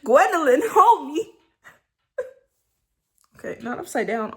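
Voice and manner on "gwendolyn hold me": much higher, louder playful cry; flustered squeal. Real, not acted. Addressing Gwendolyn while flustered, squealing. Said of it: "ain't I blushing".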